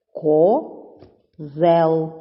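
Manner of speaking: "Козел" drawn out as Ко, pause, зел; teacher dividing "Козел": Ко... зел; split into syllables. Shown as a demonstration. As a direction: break "Козел" into two long, separate syllables. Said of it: "це слово ділиться на два склади".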